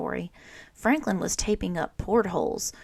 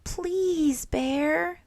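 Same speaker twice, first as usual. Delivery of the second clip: high pleading please; high childlike plea; begging; 10 semitones higher, 3 dB louder than usual. A narrator's reading. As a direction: beg him sweetly, voice lifted high. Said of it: "begged Franklin".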